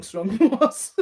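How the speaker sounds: loud, high burst of laughter mid-phrase; amused read-out breaking into laughter; laughing while reading. Real, not acted. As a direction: crack up on the last words. Cracks up reading a comment.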